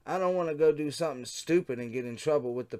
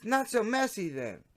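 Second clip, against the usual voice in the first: lightly voiced teen girl, much higher; young girl chat voice; 9 semitones higher than usual. Shown as a demonstration. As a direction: read it higher, as the teen decoy. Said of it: "Wait, wrong voice".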